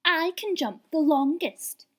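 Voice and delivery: a little rabbit bragging; boastful young rabbit. A narrator's reading. Rabbit brother bragging to his siblings, slow and high. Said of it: "said one".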